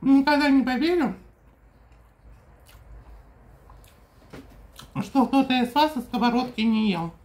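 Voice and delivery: talking with food in mouth; chewing while talking. Real, not acted. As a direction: mouth partly full. Seen 1: bite taken just before; lips closed, jaw working while she talks.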